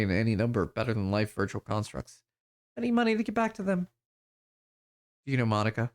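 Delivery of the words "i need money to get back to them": put-on high, small, distant girl's voice; dazed waif girl.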